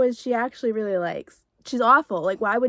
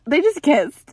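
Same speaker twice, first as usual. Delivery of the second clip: louder, thrilled repeat with JUST pushed; excited repeat; 3 semitones higher, 7 dB louder than usual. Real, not acted. Repeating to viewers that they kissed, louder and thrilled. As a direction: say it again, giddy and louder.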